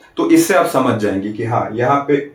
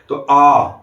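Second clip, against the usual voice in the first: usual speaking voice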